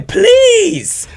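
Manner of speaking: very high, loud cry of hype; overwhelmed by a lyric, high and loud; hyped shriek. Real, not acted. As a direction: scream it in delight.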